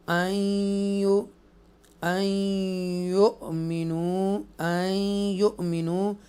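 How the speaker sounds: slow chanted tajweed reading; measured chanted recitation; Quranic recitation. Shown as a demonstration. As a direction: recite it slowly and melodically. Recites words in lesson.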